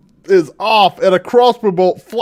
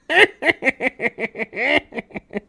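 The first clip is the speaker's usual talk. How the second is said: high, stuttering cackle sliding down; imitated cackle; 5 semitones higher, 4 dB quieter than usual. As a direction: cackle on and on in quick high bursts. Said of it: "it still would continue like".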